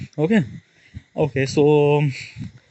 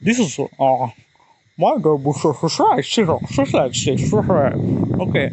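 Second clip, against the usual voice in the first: mock American accent. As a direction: play at sounding American, a bit over the top. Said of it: "they speak something really English like".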